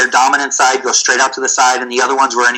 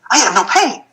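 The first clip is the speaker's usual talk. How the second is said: put-on much higher, thrilled voice of the teen; amazed kid; 9 semitones higher than usual. Injured teen patient discovering his knee works, amazed and high. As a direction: voice jumps up in delighted wonder.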